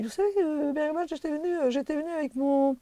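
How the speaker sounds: lightly voiced high old lady; a hint of the grand old teacher, admitting; imitating Mireille. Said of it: "Et elle me dit".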